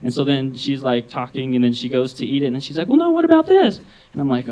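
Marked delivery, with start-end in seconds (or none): 2.9-3.8 high, loud animated voice; chatty coworker. Retold or imitated. Oblivious coworker chatting over lunch, imitated high loud chatter. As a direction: burst in high and loud, mid-argument.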